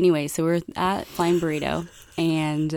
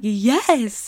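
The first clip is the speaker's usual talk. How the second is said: higher-pitched, eager yes; ecstatic; 6 semitones higher, 4 dB louder than usual. Retold or imitated. Asked for frequent flyer numbers at drive-thru, ecstatic retold answer. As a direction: retell your own thrilled reaction. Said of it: "[name] was ecstatic".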